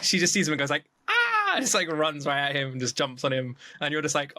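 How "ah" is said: high-pitched squeal of delight; short, very high mock scream; excited squeal. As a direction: imitate an overjoyed squeal on spotting someone. Imitates her excited squeal on seeing him.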